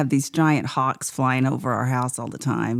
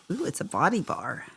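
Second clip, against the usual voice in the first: normal talking voice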